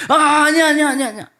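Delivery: high, loud panicked "아니야", fading fast; panicked denial. Acted. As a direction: blurt it out in terror. Peter panics and denies. (겁에 질려 다급하게 부인하는 목소리)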